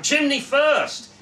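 proudly echoing the answer; loud, slow repeat. A narrator's reading. Gary echoing the explainer in the crowd, loud and slow. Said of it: "louder this time".

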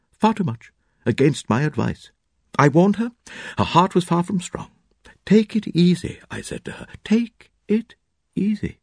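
a touch of pompous bedside lament; fussy doctor. A narrator's reading.

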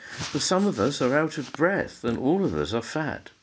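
high little oyster voice; pleading, pitched well up; oysters crying out. Acted. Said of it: "the oysters cried".

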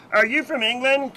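high, loud, slow American tourist voice; mock American accent. To a visitor, a high loud mock American accent. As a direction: squeal it like an excited American. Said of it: "they hear your accent and they go".

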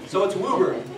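Woburn said WOO-burn, the Boston way; Woburn with an OO sound like a local; teacher repeating WOO-burn. Shown as a demonstration. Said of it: "I can't do a Boston accent".

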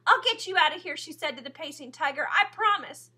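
high, loud fervent vow; fierce promise. A narrator's reading. Promise to tiger, emotional.

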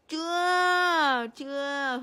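loud, falling playful scold; hammed-up loud scold; mock scolding. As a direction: pretend punishment. Mock punishing baby.

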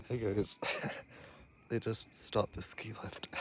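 hushed, breathless whisper through a laugh. Real, not acted. To viewers on the stalled lift, a giggly whisper. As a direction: whisper it so nobody hears.

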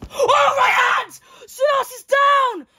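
real shouted excitement, high and loud; excited shout. Real, not acted. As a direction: yell it as the fighter drops. Knockdown in live fight commentary.

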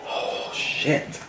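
airy gasp of pain; whispered curse through the burn; breathless gasp. Real, not acted. Gasping after spicy wing.

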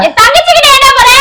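high, loud furious repeat; angry yell. Acted. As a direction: shout the question at him, enraged. Wife yelling at husband. (கோபமான கத்தல்)

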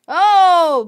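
sudden loud high Oh; real startled cry; startled yelp. Real, not acted. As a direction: jolt of shock, loud. Sudden loss in agar game.

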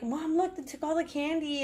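a hint of a delighted kid showing mom; imitating her son. Mom quoting her young son.

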